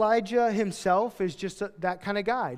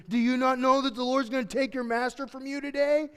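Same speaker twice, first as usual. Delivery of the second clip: high-pitched, insistent busybody; pestering question; 7 semitones higher, 3 dB louder than usual. Acted. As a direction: pester him in a raised, high voice. Acts out the prophets' nagging question.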